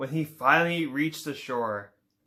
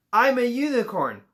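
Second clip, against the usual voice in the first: Kelp's announcement; 5 semitones higher, 3 dB louder than usual. Acted. Kelp announcing news.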